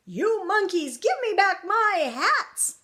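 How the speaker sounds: loud, high, hammed-up scolding; storybook shout, slowing on the demand; angry yell. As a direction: yell at the thieves as an outraged hat maker. Hat maker shakes fist at monkeys.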